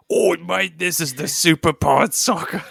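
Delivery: hammed-up Aussie accent sliding into terrible Cockney; bad Australian-to-Cockney accent. Shown as a demonstration. Co-host showing off a different accent for the show intro, bad Australian-Cockney accent. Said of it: "went, like, really bad, like, Cockney".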